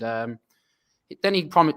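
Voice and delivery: ordinary talk, nothing marked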